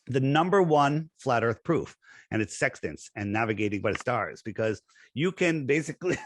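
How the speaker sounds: ordinary talk, nothing marked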